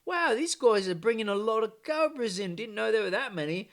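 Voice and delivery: high, loud hammed-up British accent; mock British accent. British paying out for cobras, high mock British accent. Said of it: "And the British are like".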